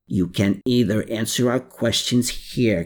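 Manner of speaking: usual speaking voice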